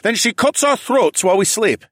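high, loud accusation; huffy. A narrator's reading. Local man warns the group about Adhara, huffy. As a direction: press the suspicion in a huff. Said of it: "the man huffed".

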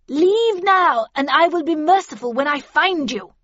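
high, loud threatening shout; shouted threat. A narrator's reading. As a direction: yell a cold threat outside. Havisham shouting back outside.

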